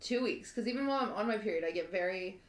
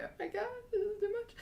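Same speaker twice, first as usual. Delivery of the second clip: "Oh my God, this is too much" squeaked far higher, voice breaking; imitating crying; 12 semitones higher, 4 dB quieter, faster than usual. Retelling her tears in the theater, a high crying voice. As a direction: reenact sobbing over the sad short. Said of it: "I start crying".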